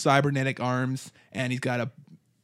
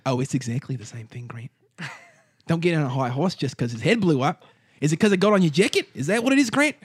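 bald gang boss; faster than usual. Acted. Gang boss to Grant about his jacket, taunting villain voice. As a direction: needle him in a swooping put-on accent.

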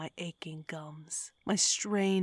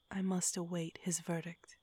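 usual speaking voice

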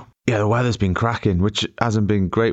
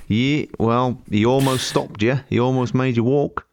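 mock Aussie guard, pitched up; amateur higher-pitched Australian accent; attempted Australian accent; 4 semitones higher than usual. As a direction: imitate an Australian official making his point. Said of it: "It's still not Australian".

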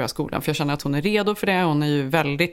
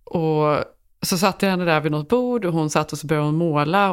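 ordinary talk

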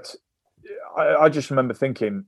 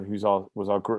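ordinary delivery

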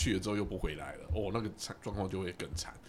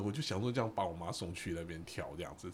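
usual speaking voice

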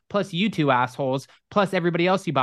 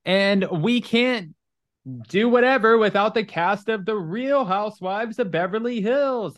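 slow, drawn-out showbiz announcer; milk each word like a gala host; announcer build-up; 6 semitones higher, slower than usual. Retold or imitated. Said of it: "they're like".

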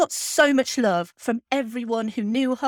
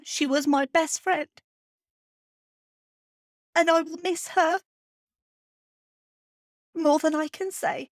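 higher, halting voice close to crying; tearful; 5 semitones higher than usual. Real, not acted. Podcaster saying how much she misses her cat, tearful. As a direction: say it through real tears, with long pauses. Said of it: "tell me about the crying".

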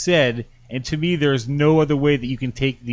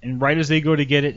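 usual speaking voice

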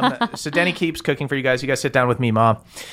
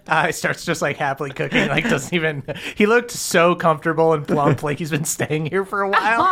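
ordinary talk, nothing marked

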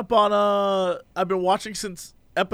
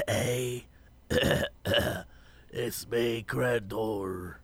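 low, croaky sick voice; sick hoarse voice; 8 semitones lower, 10 dB quieter, much slower than usual. Retold or imitated. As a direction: croak it out like you're ill. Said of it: "you're like".

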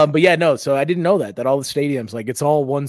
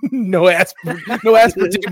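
louder, higher, laughing through the pitch; 5 semitones higher, 6 dB louder, slower than usual. Real, not acted. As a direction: crack up mid-advertisement. Laughs during his mock sponsor read.

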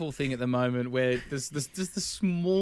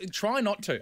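ordinary talk

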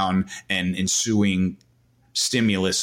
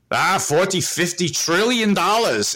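put-on Swiss German accent; 10 semitones higher than usual. Imitating the guest's answer on the podcast in a Swiss German accent. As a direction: drop the big number in a Swiss German accent. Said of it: "in his Swiss German accent".

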